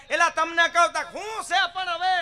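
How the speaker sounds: high, loud exasperated back-and-forth; exasperated call. Retold or imitated. Wife and husband shout across the street, exasperated. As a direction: call him back a third time, fed up. Escalating calls.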